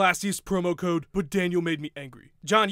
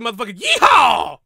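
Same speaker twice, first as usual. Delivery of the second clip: louder whooping cowboy holler; yeehaw shout; 3 semitones higher, 10 dB louder than usual. Acted. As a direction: let out a rowdy yeehaw. Cowboy whoops at press conference.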